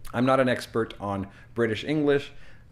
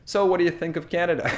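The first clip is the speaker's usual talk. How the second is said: fast, higher chatty question; imitated quick small talk; not slowed at all; 6 semitones higher, 4 dB louder, much faster than usual. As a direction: rattle the question off despite the promise. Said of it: "you didn't slow down at all".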